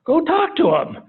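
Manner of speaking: high, loud burst, like the obvious answer; punchy exclamation, pitched well up; emphatic answer. Acted. Answers his own question, calling out.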